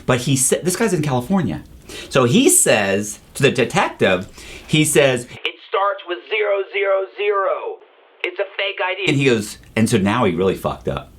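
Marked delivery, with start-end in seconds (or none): 5.2-9.0 high, strained mock scream, kept soft; distant, high-pitched mock yelling; retold screaming. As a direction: imitate an irate caller yelling through a phone. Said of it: "he starts screaming".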